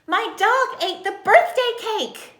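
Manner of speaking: slow, high, loud exclamation; big expressive yell for an exclamation point; exclamatory yell. Shown as a demonstration. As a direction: shout it out word by word like shocking news. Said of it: "say that with a lot of expression".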